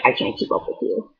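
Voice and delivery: breathless whisper. Retold or imitated. Talking to her energetic friend, tired whisper. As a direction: whisper it, worn out. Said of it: "'Cause I'll be like".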